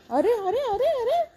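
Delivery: high surprised calls; startled calling. Real, not acted. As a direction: call out in alarm. Dog runs off, calling out. (चकित होऊन हाक)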